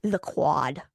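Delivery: low, dropped-out "the quad"; "the quad" blurted low in the throat; regurgitated answer. Real, not acted. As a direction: cough up "the quad" like a reluctant answer. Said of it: "the way I just, like, regurgitated that answer".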